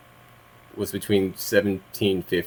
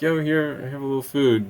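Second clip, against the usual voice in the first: coaxing; 7 semitones higher than usual. Retold or imitated. Coaxing the raccoon with food.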